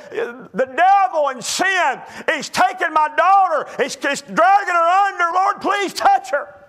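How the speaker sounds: put-on father crying out against the devil taking his child, anguished shout; desperate cry. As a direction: cry out to the Lord for help. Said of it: "You know what it could have been saying?".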